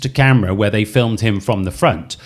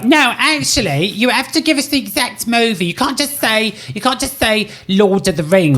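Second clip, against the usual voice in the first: high, mock strict quizmaster voice; lightly imitating a stickler host; mock fussy host; 7 semitones higher than usual. Co-host mimics partner's fussy quiz rules, higher and mocking. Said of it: "that you were like".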